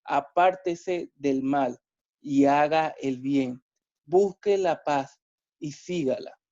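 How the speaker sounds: measured, solemn Bible reading; calm, deliberate scripture reading. Acted. Reads the Bible passage aloud.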